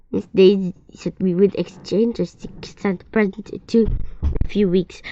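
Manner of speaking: usual speaking voice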